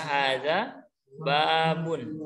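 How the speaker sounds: hadza babun drawn out, very slow; slow model phrase. Shown as a demonstration. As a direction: stretch "hadza babun" so they can follow. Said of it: "siapa yang mau coba?". (ucapkan "hadza babun" sangat pelan)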